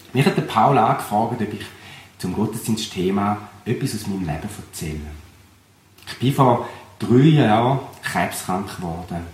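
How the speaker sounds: ordinary talk, nothing marked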